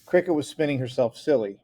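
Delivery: plain reading voice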